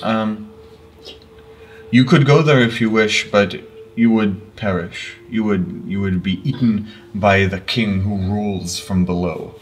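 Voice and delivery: mournful elder, a light Russian lilt; lightly voiced elder, sorrowful; solemn elder. DM voicing NPC Zerus.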